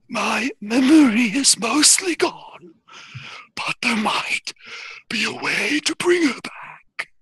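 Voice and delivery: slow, halting otherworldly character voice; Exothanter. Acted. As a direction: draw each phrase out in a strange creature voice. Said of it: "Exothanter says".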